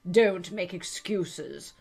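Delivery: stern guard voice, slow; a railway guard scolding the girl; gruff guard. Acted. Guard rebukes Alice for excuses, sternly.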